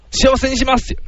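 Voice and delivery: mock-earnest boyfriend's vow to her father; determined suitor. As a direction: declare it like a keen son-in-law. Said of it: "結婚への覚悟はどのくらいか".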